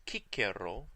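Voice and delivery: Cicero with hard k's, KEE-keh-ro; restored pronunciation with kappa. Shown as a demonstration. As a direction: say both c's in Cicero as k. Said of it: "wrote his name using kappa".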